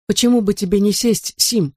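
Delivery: lightly voiced coaxing wife. A narrator's reading. Wife suggests her spent husband sit, gentle coaxing.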